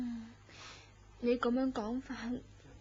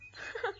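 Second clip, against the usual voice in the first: airy giggle; 8 semitones higher, 5 dB quieter than usual. Acted. Reacts to the ghost story.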